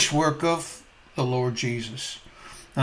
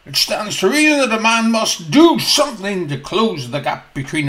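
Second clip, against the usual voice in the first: loud, higher, scoffing declaration; pompous doctor scoffing at Grace; scoffing Doctor Law; 5 semitones higher, 6 dB louder than usual. Acted. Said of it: "then declares".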